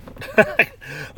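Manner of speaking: sudden high burst of laughter; a quick, breathy high laugh; short laugh. Real, not acted. Laughs at hating to drive.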